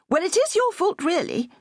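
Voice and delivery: a touch of a strict schoolmistress; headmistressy. A narrator's reading. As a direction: scold like a prim headmistress. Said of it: "said the headmistressy voice of conscience".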